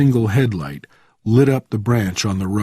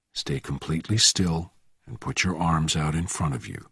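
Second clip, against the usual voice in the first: low, soft command; hushed, controlled menace; quiet threat; 5 semitones lower, 7 dB quieter than usual. A narrator's reading. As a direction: give the order quietly and lower. Bourne quietly threatens the driver.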